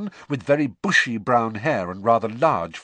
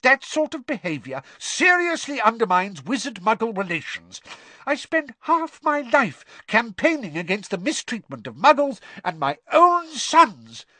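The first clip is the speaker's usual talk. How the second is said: high-pitched indignant tirade; angry shouting; 13 semitones higher, 5 dB louder than usual. A narrator's reading. Father lecturing sons on mistreating muggles, shouting angrily. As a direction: rant at them, swelling louder at the end. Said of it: "Mister Weasley shouted".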